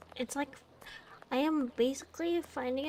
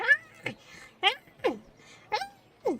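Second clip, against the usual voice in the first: high mock screams, repeated; mock screaming; 14 semitones higher, much slower than usual. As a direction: imitate a woman screaming in the street. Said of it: "all of a sudden you see".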